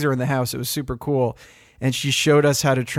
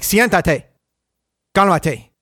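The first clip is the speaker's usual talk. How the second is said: firm, louder Spanish commands to the dog; higher and louder, firm Spanish dog commands; assertive command; 4 semitones higher, 4 dB louder, slower than usual. Retold or imitated. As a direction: say it in Spanish like you mean it. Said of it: "a little bit more assertive in Spanish".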